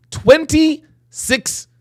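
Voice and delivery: high, very slow disbelief; incredulous emphasis. Real, not acted. Host harping on the number of trips, incredulous. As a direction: drag the number out high, aghast.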